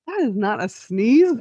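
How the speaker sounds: mock disbelief. People reacting to her held-in sneeze, incredulous. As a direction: imitate onlookers who refuse to believe it.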